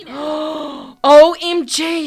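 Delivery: loud, drawn-out spelled exclamation; mock shock. Player watching her scheme, mock shock. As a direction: stretch each letter in high amazement.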